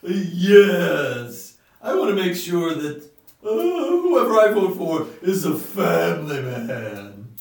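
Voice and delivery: loud, slow, insistent townhall voter. Acted. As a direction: demand it slowly from the back row. Voter asks candidate a question.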